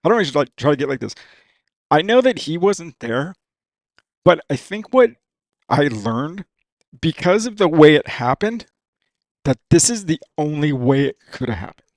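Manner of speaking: choking up while trying to hold it together; tearing up. Real, not acted. Racer remembering his dad after a big win, tearing up. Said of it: "sorry, dude, I'm trying to, like, hang together".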